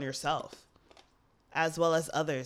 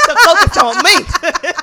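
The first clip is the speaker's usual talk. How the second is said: slow, high laugh-filled words; 11 semitones higher, 18 dB louder than usual. Real, not acted. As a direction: say it while cracking up. Laughing at the joke.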